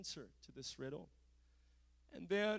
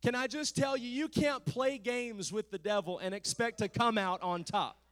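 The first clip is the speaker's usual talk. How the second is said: ordinary talk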